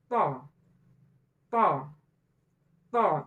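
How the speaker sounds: "To" with the t pressed hard, no puff of air; loud, thick, unaspirated "To"; pressed heavy to. Shown as a demonstration. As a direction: push the tongue firmly for each "To". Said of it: "Ada tekan".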